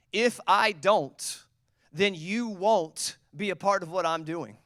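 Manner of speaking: slow firm declaration. Acted. Jesus answering Peter.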